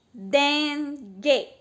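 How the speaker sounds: Dengue pulled apart as DEN, then GUE; both syllables of Dengue stretched and separated. Shown as a demonstration. Teacher repeating for pupils to count, Dengue broken into den-gue. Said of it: "Cada vez que eu abro a boca".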